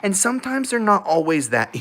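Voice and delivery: normal talking voice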